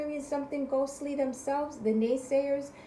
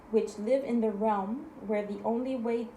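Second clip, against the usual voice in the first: usual speaking voice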